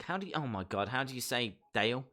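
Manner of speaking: usual speaking voice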